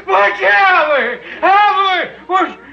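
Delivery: panicked calling. Acted. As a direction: yell for the cat to save you. Man calls his cat for help.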